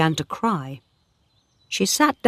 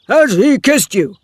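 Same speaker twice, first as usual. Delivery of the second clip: a louder, higher demanding shout; 7 semitones higher, 8 dB louder than usual. A narrator's reading. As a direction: shout the question at her. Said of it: "he shouted".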